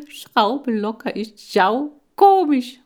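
high, loud, slow laughing hamster voice; Schmatzi voice. Acted. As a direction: spuckt die Worte vor Lachen. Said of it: "meinte Schmatzi". (hoch, laut und langsam, als ob er sich kaum halten kann)

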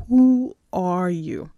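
ordinary talk

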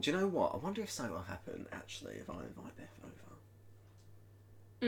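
soft, slowing, sly thought; scheming inner voice. Retold or imitated. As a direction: let it sink to a secret murmur. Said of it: "And she thought".

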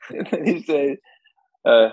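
normal talking voice

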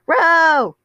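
high, loud cry that cracks. Real, not acted. Patton greets Roman scooping them up, voice cracking on a high cry. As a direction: call out the name till the voice breaks. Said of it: "my voice cracked there".

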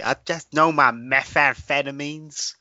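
slow Texan drawl; Texan accent. Retold or imitated. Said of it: "I was supposed to say".

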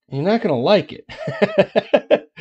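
slow, high, drawn-out teasing warning. Acted. Teasing warning before bad news.

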